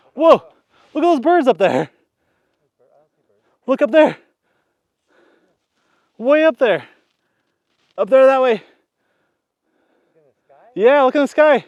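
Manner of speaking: high, loud shout pointing upward; excited calling out. Real, not acted. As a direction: call out urgently so they look up. Calling out a bird sighting.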